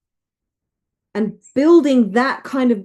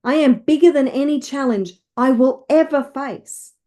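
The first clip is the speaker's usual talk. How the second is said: emphatic, declarative affirmations; rousing self-belief, leading others to repeat; with conviction. Shown as a demonstration. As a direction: declare each one like you mean it. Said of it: "Say it with conviction".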